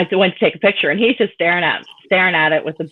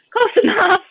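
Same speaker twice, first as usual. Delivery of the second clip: laughing answer; 3 dB louder than usual. Real, not acted. Mother's answer through laughter.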